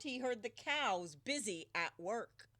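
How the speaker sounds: ordinary delivery, nothing marked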